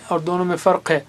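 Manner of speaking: ordinary talk, nothing marked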